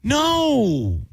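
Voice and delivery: high, drawn-out denial easing into a question; disbelief. Real, not acted. Host hearing it confirmed by co-host, high stunned denial. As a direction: refuse to believe it, voice way up.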